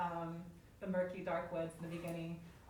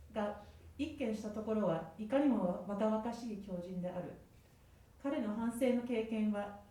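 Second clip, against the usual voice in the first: literary reading. Acted. Reads Japanese original aloud.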